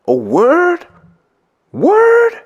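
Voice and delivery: shrill leap up in mock disbelief; hammed-up incredulous word; mock surprise. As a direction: imitate a hanger-on's fake astonishment, high and loud. Hanger-on reacting to the fee.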